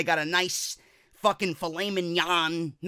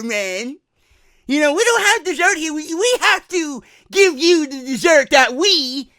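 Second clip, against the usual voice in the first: higher, loud put-on employee; lazy buffet employee; 7 semitones higher, 9 dB louder than usual. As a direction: employee explaining no dessert. Employee voiced for Kurt.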